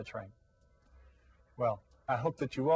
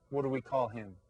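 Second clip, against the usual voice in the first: normal talking voice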